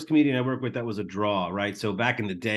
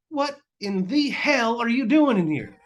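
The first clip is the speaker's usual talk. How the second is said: much higher and louder, an outraged what-in-the-hell; yelling at heckler; 11 semitones higher, 5 dB louder than usual. Retold or imitated. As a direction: bellow it across the room at him. Tim yells at the returning heckler.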